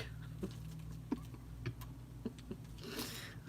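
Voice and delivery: wheezy, airless laugh; a strangled laugh like a cat. Real, not acted. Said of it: "Sound like a strangled cat".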